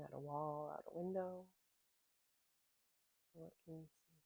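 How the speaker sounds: calm, unhurried voice with long pauses; gentle grounding-practice guide; guided meditation. Real, not acted. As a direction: ease listeners into their senses, letting phrases settle and fade.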